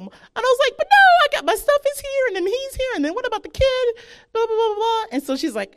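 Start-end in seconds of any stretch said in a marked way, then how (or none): 0.8-5.0 very high mock whining protest; crying. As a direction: blubber excuses in a hammed-up squeal. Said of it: "crying, and I'm in traffic".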